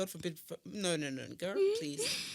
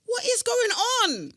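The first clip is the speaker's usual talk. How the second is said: loud, high, drawn-out disbelief; exasperated high shout; mock outrage; 13 semitones higher, 10 dB louder, slower than usual. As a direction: cry it out slowly in comic outrage. Mock outrage at friend's pictures.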